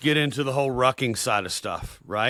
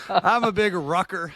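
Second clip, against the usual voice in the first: higher, slower, just after cracking up; barely keeping straight face; 7 semitones higher than usual. Real, not acted. Host retrying the line after the rucking joke, barely keeping a straight face. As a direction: hold back real laughter on a drawn-out line. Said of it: "keep a straight face now".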